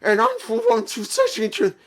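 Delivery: put-on high, loud slurred drunk voice; drunk impression. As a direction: ham up a tipsy wrestler. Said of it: "he sounds like this".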